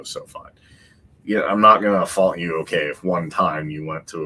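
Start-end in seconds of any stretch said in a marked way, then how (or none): 0.0-0.5 whispered. Retold or imitated. Unvoiced resigned aside.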